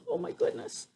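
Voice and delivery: very soft high oh my goodness; hushed amazement. Real, not acted. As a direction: breathe it out, stunned.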